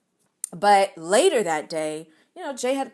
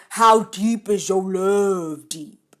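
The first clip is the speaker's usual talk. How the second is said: deep voice; 4 dB louder, slower than usual. Retold or imitated. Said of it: "really deep voice".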